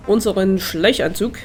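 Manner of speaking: usual speaking voice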